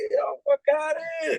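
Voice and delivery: high-pitched excited yelp; can't believe it's on TV; hyped disbelief. Retold or imitated. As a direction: yelp it like you just saw your song on TV. Seeing Shaq walk out to his track.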